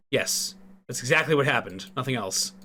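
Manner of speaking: ordinary talk, nothing marked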